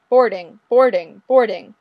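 three loud boardings; loud announcement. Acted. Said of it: "the monitor is now flashing".

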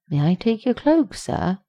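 servant's polite question. A narrator's reading. Attendant asks Rodney for his cloak, polite lilt. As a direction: offer it like an attendant. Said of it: "a beautiful dark-skinned man asked Rodney".

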